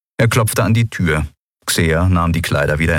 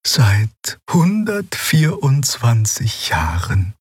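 put-on very high, slow, otherworldly old healer; old Draxyl healer; 5 semitones higher, much slower than usual. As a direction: speak as the ancient gentle alien doctor. Xea antwortet ergeben. (hohe, langsame, fremdartige Stimme einer alten Heilerin)

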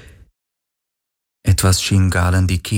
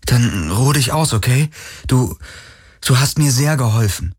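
put-on higher, gentle young hero voice; young Galen; 5 semitones higher than usual. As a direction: soothe a dying friend, voice raised higher. Galen comforts the ghost.

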